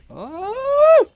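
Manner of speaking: a sharp cry as the game turns on him; startled yelp. Real, not acted. Losing a round, a sudden loud high yelp.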